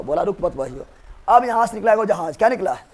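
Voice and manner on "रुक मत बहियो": low, much softer acted retort; muttered mock threat. British side warns the protesting colonists, muttered low mock threat. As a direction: drop low and soft for the retort.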